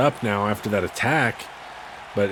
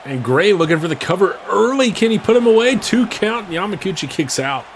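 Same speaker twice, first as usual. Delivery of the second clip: higher and louder, rising to a shout; excited near-fall call; 7 semitones higher, 4 dB louder than usual. Real, not acted. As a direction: shout the count as it happens. Near-fall called live.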